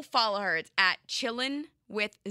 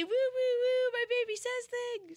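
squealing high doting-parent voice; melty new parent. Pretend. Teasing her co-host about future parenthood, gushing high doting voice. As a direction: gush over the baby like a smitten mom. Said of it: "you're all melty".

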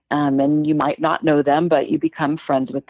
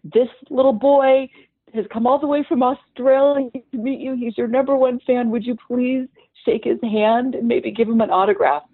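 high, imploring ask on someone's behalf; earnest plea; 8 semitones higher than usual. Retold or imitated. A mother begging the skater for her son, pitched high and imploring. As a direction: plead up high for the little fan.

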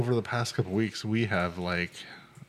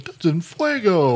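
high, loud, slow hammed-up sports call; sportscaster; 9 semitones higher, 5 dB louder, slower than usual. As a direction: belt it like a hyped commentator. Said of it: "Dick Vitale or whatever?".

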